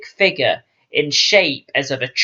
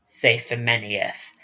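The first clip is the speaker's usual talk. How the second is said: ordinary delivery, nothing marked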